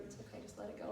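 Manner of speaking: hushed comforting murmur; soothing healer. Retold or imitated. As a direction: imitate a healer calming someone. Healer soothing her, retold.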